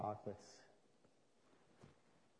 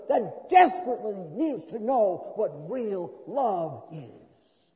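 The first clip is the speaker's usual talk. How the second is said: loud, high, slow full-force preaching; 6 semitones higher, 13 dB louder, slower than usual. Acted. Pastor urging congregation to show love, full-force preaching. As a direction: thunder it from the pulpit.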